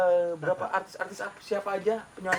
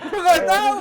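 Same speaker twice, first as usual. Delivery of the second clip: laughing; 12 semitones higher, 9 dB louder than usual. Real, not acted. Laughing loud reply.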